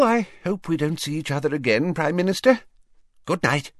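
high, fussy official voice; Fudge voice. Acted. Fudge answering the Prime Minister in a high fussy voice. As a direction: play him as a flustered minister.

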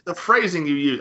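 ordinary talk, nothing marked

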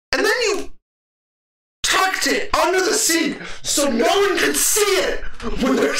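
high, laughing outrage; laughing rant. Retold or imitated. Mocking the customer who hid ice cream, laughing high rant. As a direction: rant while cracking up.

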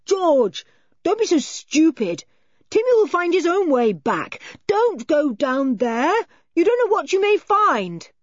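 high, loud exasperated scolding; aggravated shout. A narrator's reading. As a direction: shout after a reckless friend. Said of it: "gave a shout of aggravation".

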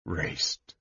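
very soft, low murmur; said softly. A narrator's reading. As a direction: murmur the name, barely voiced. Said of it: "he said softly".

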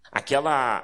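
ordinary talk, nothing marked

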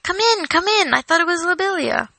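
high, flustered welcome; crying out. A narrator's reading. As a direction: usher a guest in with an eager high-pitched call. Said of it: "cried Frodo".

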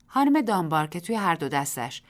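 plain reading voice

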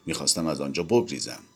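usual speaking voice

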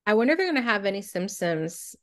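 usual speaking voice

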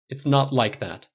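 slow, firm harsh denial, stress on not. Acted. Tony cutting off the stylist, harsh and slow. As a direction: bite off "It's not like that", "not" hard. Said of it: "tone unexpectedly harsh".

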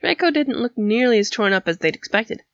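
usual speaking voice

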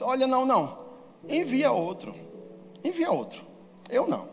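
high, falling protest; acted reluctant refusal, pitched high; refusing with excuses. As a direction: beg off and pass the job to someone else. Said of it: "colocando todas essas desculpas".

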